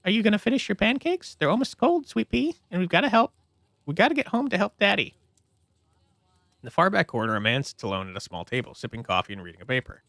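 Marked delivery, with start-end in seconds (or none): 0.0-5.2 higher, hurried mother voice; young mother. Acted. Mother hurrying her child to finish pancakes, higher voice. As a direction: chivvy the child along.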